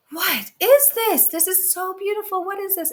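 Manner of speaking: high, loud astonished cry; loud high wonder; amazed. Acted. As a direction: marvel at the treasure falling out. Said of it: "And they're like, gasps".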